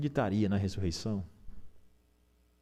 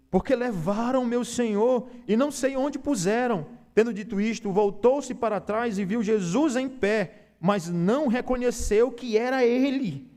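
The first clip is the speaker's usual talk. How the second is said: higher, louder preaching climax; fervent preaching; 11 semitones higher, 4 dB louder than usual. Acted. Pastor preaching the empty tomb to the church, fervent and loud. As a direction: lift the voice and preach with fire. Preaching rises to full force.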